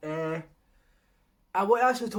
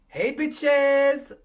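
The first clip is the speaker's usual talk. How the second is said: high loud jokey yell; mock loud greeting; 9 semitones higher, 9 dB louder than usual. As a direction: shout it out as a joke. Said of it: "No, joking".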